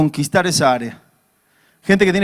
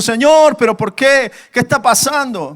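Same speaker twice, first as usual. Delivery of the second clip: high, raised protest to God; pleading outcry; 5 semitones higher, 4 dB louder than usual. Acted. Preacher acts believers questioning the Lord, pleading cry. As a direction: cry out in bewildered complaint. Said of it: "¿cuántas veces hemos dicho".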